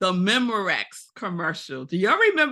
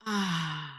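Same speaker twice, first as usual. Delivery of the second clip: low, soft exhaled ah; soft ah sound; 4 semitones lower, 7 dB quieter than usual. Shown as a demonstration. Guiding listeners to breathe, a low soft sighed ah. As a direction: let the ah fall out on the breath. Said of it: "that sound is".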